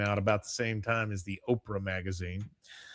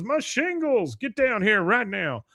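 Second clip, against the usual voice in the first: much higher, louder outburst; outraged yell; 9 semitones higher, 5 dB louder than usual. Retold or imitated. Homeowner yells at his neighbor about the shingles, higher and loud. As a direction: shriek about the ruined roof. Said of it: "He goes".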